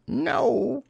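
very high squeaky disguise; different sort of voice. A narrator's reading. Rabbit disguising his voice from Pooh, high squeak. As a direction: jump right up into falsetto. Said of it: "in a different sort of voice this time".

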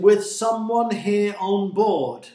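loud, high, slow accusation; crying out. A narrator's reading. Captain accusing someone on board, crying out. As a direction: shout it slowly to the whole crew. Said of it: "cried the captain".

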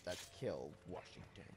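low hushed menacing voice; evil whisper. Shown as a demonstration. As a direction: murmur it like a villain. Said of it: "sound very evil".

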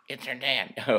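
usual speaking voice

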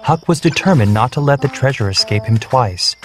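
ordinary delivery

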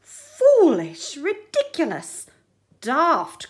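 scornful cry, starting high and loud then dropping back; mock the crab's outfit as the crowd. Hermit crabs scoffing at Henry's decorated shell, scornful. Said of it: "cried the rest".

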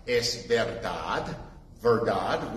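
"es verdad" in Spanish, slow, soft and low; Spanish word. Shown as a demonstration. Preacher giving the Spanish to Clara, slow Spanish. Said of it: "In Espanol, Clara".

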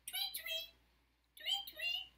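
high-pitched "tweet, tweet" chirps; bird tweet. Pretend. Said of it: "and says".

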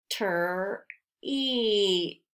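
t t whispered, then ter, ter, eat as separate sounds; sounding out treat. Shown as a demonstration. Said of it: "be careful with that ter".

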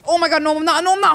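high, loud excited outburst; frantic excitement. Real, not acted. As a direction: shout it in a frantic rush. Sudden near success in game.

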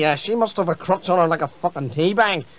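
normal talking voice

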